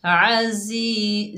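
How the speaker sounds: जी in आजी drawn very slowly; one-count madd. Shown as a demonstration. Teacher showing students madd with ya, slow recitation. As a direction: chant आजी holding the ई long. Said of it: "एक अलिफ की मिकदार खींच कर".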